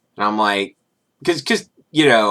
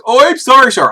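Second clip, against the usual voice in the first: put-on high, louder apologetic Indian-accented recruiter; hammed-up Indian accent; mock Indian accent; 8 dB louder than usual. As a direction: play the Indian recruiter backing down. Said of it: "from Indian recruiters".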